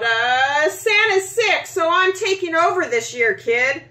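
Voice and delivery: flustered, high, loud and fast; blurting out. A narrator's reading. Atnis caught off guard by the boy, blurting it out high and fast. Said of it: "blurts out".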